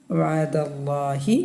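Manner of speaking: slow, drawn-out tajweed recitation; Quran recitation. Shown as a demonstration. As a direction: recite it slowly for the students. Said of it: "teacher models Quran recitation". (ഖുർആൻ പാരായണ ശൈലിയിൽ)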